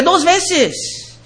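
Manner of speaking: ordinary delivery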